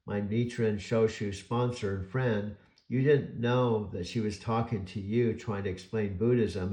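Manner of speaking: ordinary talk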